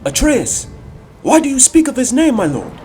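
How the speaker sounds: high, loud soldier's protest; agitated challenge. Acted. Spartan questions his lord about dead Atreus, agitated.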